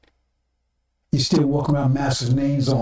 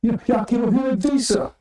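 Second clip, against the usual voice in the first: mock sweet talk; 9 semitones higher than usual. Voicing oppressors sweet-talking listeners.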